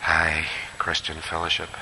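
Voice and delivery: wry aside. Acted. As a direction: drop it low like a rueful joke. Preacher's wry repeat.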